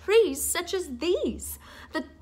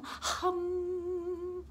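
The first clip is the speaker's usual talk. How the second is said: soft hum; 4 semitones higher, 10 dB quieter than usual. Shown as a demonstration. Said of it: "Can I hear you all be little humming fish?".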